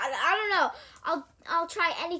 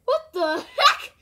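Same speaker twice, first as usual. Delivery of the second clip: loud, high, drawn-out yelp; high-pitched startled shout; startled outburst; 10 semitones higher, 13 dB louder, slower than usual. Real, not acted. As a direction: shout it out in shock, stretching the words. Sudden outburst at something off-screen.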